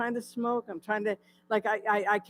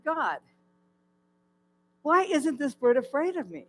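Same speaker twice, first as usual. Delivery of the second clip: calling out to God; 7 semitones higher, 8 dB louder than usual. Retold or imitated. Crying out to God.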